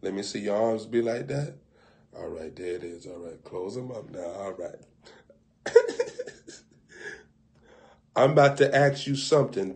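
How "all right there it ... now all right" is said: softer, lower and calm; quiet coaching. Acted. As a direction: coax them gently.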